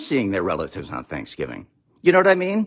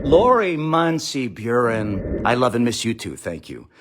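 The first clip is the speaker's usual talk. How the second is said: ordinary talk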